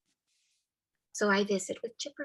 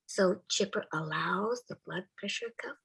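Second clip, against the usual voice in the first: ordinary talk, nothing marked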